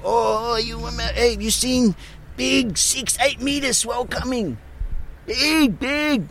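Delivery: put-on squeaky young boy shouting about the swell; excited local kid. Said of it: "laughing at this kid going".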